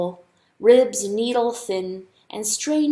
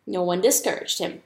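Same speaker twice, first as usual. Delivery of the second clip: plain reading voice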